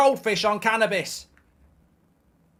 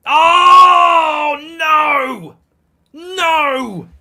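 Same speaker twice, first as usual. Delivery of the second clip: raw, loud cry of despair as the goal goes in; anguished shout; 6 semitones higher, 10 dB louder, much slower than usual. Real, not acted. Fan shouting in despair as Brighton score. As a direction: wail it out like your team just conceded.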